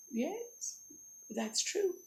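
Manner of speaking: soft, coy dragon agreement; flattered dragon. Acted. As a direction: agree softly, pleased with yourself.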